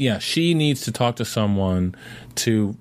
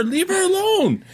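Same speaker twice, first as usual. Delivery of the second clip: much higher, slow and insistent; high exasperated plea; slower than usual. Real, not acted. Arguing with her friend about the mom, high slow emphatic plea.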